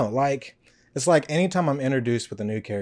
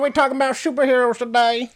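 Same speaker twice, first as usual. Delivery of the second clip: newsboy voice; 10 semitones higher, 5 dB louder than usual. Pretend. Continues newsboy voice.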